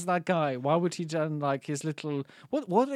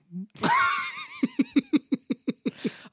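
shrieking laugh. Real, not acted. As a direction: let out a squealing laugh. Big laugh.

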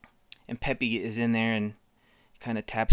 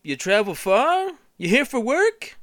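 high, slow, goofy small talk; goofy driver voice; 11 semitones higher, 7 dB louder, slower than usual. Retold or imitated. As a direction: chat like a dopey cabbie. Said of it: "He's like".

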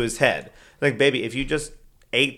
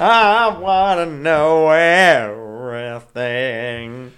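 louder, stretched out, dragging it; slow, drawn-out echo; 2 semitones higher, 6 dB louder, much slower than usual. Pretend. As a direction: mimic the line slowly and loud. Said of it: "Hey, that worked".

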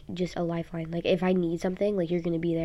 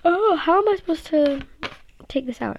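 1 frustrated exclamation; 10 semitones higher, 7 dB louder than usual. Real, not acted. Struggling with a picture frame.

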